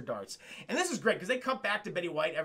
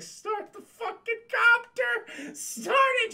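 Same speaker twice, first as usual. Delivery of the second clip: high, loud panicked yelling; frantic yell; 5 dB louder, much slower than usual. Retold or imitated. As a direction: scream at her to start it. Panicked yelling in retold scene.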